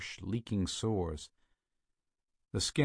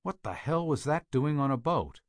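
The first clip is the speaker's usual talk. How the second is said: usual speaking voice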